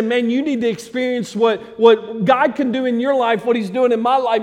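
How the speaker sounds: high, loud, eager urging; urgent invitation. Retold or imitated. David urging others to experience God, loud and eager. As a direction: grab a friend and pull him in.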